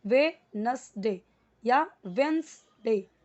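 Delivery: वेडनेसडे and वेडनेसडे said slowly, d kept silent; slow demonstration. Shown as a demonstration. Teacher repeating both ways for learners, slowly. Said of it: "डी silent है".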